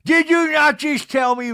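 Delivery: high, loud, hammed-up disbelief; mock affronted question; imitated outraged comeback; indignant challenge. Meredith's indignant challenge, retold.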